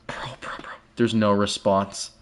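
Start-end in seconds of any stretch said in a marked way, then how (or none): 0.1-0.7 whispering to chat in dread. Real, not acted. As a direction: hush it, scared of what comes. Tense moment, streamer hushes.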